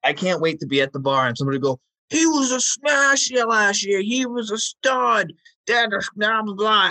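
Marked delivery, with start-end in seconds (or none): 2.1-6.9 high, slow Boston bar guy; thick Boston accent, drawn out. Retold or imitated. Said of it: "thick Boston accent you laid on there".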